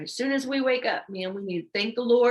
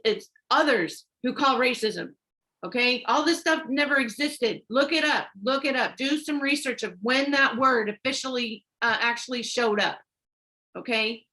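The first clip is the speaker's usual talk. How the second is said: ordinary talk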